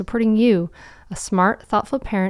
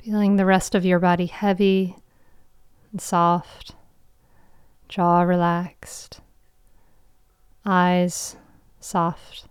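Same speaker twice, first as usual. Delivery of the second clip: guided meditation. Acted. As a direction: guide them into calm, with long pauses.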